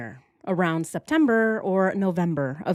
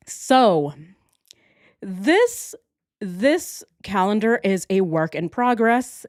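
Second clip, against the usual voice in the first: ordinary delivery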